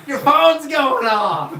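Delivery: put-on mad Southern accent, high drawn-out yell; Melissa. Host imitating a friend nagging at night, mad Southern accent. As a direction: holler it in a hammed-up Southern twang. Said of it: "why does she have a southern accent when she's mad at me".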